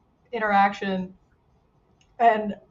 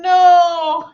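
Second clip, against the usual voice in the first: shocked shout; 7 semitones higher, 9 dB louder than usual. Real, not acted. Reacting to big score.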